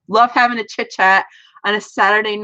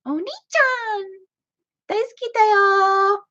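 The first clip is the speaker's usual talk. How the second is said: put-on high sweet anime girl voice; cutesy anime voice; 14 semitones higher than usual. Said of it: "demo reel of all my cutesy anime voices".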